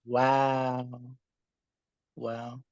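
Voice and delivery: usual speaking voice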